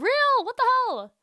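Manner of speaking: high, loud disbelieving cry; can't believe the spelling; incredulous outburst. Real, not acted. Reacting to French spelling with high disbelief.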